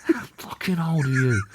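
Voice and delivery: mock-incredulous outburst; angry. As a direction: repeat it in indignant disbelief. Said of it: "'Cause I was really angry".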